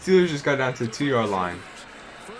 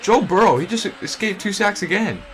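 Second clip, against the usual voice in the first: excited; 7 semitones higher, 5 dB louder than usual. Real, not acted. Excited reaction to a play.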